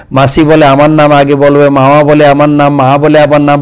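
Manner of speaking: ordinary talk, nothing marked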